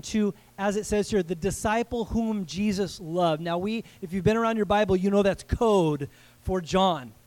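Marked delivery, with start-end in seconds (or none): none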